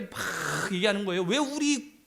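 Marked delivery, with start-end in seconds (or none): 1.2-2.0 higher-pitched mock protest; imitating a complaint. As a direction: press the grievance like an aggrieved petitioner. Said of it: "막 따지는 거야".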